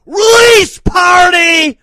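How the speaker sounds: screamed high and loud, falling at the end; hype scream. Acted. Host hyping the release party to listeners, screaming. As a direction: belt it like a party announcer.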